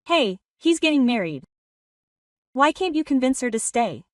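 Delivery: ordinary delivery, nothing marked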